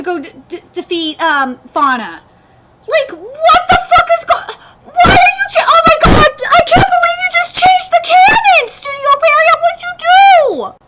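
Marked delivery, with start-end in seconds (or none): 2.9-10.7 high, loud, near-crying outrage; furious shout. Real, not acted. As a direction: scream at the studio in disbelief. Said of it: "I'm so fucking mad right now".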